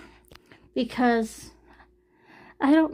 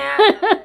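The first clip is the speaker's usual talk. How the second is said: burst of laughter; 8 semitones higher, 7 dB louder than usual. Real, not acted. Laughing at death joke.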